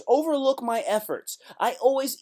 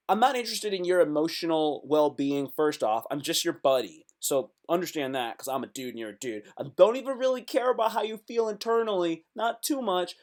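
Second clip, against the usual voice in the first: ordinary talk, nothing marked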